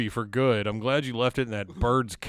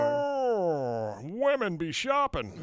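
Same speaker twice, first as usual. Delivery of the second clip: mock comedian; 5 semitones higher, 3 dB quieter, much slower than usual.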